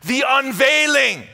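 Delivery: shouted, high, rising preacher's cry; preaching at full force. Acted. Pastor proclaiming Christ to the church, shouting. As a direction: build it to a roaring climax.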